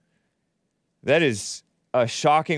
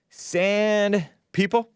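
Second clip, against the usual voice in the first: drawn out slow and high; slow teasing name; slow amused; 7 semitones higher, much slower than usual. Real, not acted. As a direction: savour the words slowly.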